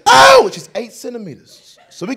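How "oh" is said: very high, loud yelp; retold labor cry. Wife in labor cries out.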